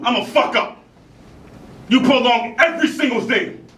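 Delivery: high, heated outburst; angry accusation. Acted. Actor blaming the one who holds them back, angry. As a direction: hurl the blame at them. Acted quarrel, blaming the other.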